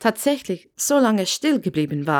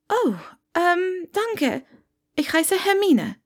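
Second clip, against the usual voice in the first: put-on much higher, hesitant girl's voice; shy young witch taken by surprise; flustered young Hermine; 10 semitones higher, slower than usual. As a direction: caught off guard, stumbling politely.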